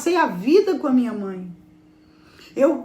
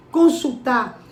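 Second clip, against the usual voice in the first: ordinary talk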